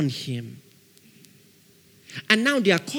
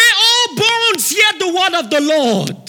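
much higher, loud call to the bones; full-force proclamation; 13 semitones higher, 9 dB louder, slower than usual. Acted. Prophet calls out over the dry bones, loud and high.

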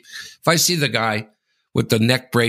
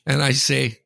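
normal talking voice

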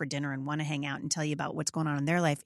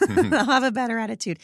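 laughing; 5 semitones higher, 7 dB louder than usual. Real, not acted.

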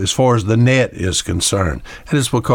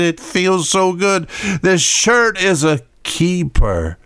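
higher, slow, savouring; cling to the old shirt lovingly; mock-sentimental husband defending his shirt; fond husband; 7 semitones higher, slower than usual.

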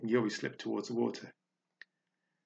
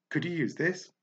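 high little helpful voice; light, eager little voice; small creature voice; 6 semitones higher than usual. Acted. Small mouse character Mousetrap speaks.

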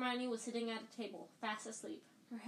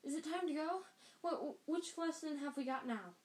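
higher, stammering and flustered; a girl caught off guard, voice lifted; lightly voiced flustered schoolgirl; flustered Hermione; 6 semitones higher than usual.

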